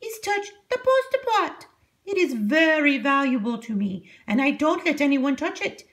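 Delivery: put-on high old witch voice; Strega Nona voice. A narrator's reading. Strega Nona instructs her new helper, high old witch voice. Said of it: "said Strega Nona".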